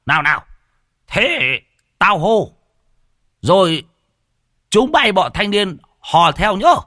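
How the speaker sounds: put-on rally the young ones to drink, shouting the count; fierce drinking toast. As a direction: drunk old uncle bellowing out a toast count at the memorial feast. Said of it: "cũng hung hăng".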